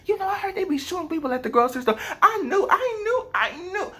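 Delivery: a high, fast, giggly gossip; mock gossip. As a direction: gush the rumour with a laugh. Exaggerated gossip voice.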